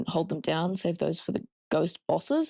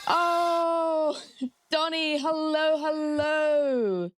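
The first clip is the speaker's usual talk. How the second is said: excited greeting; 9 semitones higher, much slower than usual. Real, not acted. Greeting raiders in sing-song.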